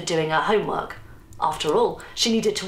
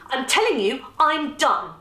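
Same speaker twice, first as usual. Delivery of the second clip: raised-pitch, firm insistence; insistent; 7 semitones higher than usual. A narrator's reading. Easter tells her mother she is done, insisting. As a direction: press each phrase home firmly. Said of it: "Easter insisted".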